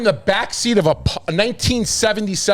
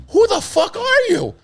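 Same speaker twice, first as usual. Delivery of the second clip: much higher, slow startled question. Retold or imitated. Answering the stranger at the rave, high and startled. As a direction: blurt it out in shock. His shocked reply.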